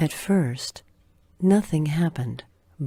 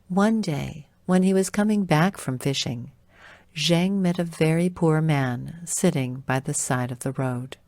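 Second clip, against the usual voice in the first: usual speaking voice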